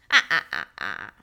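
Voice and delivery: booming menacing ha ha ha; sinister Cyclops laugh; evil giant laugh. Acted. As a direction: cackle like a hungry monster.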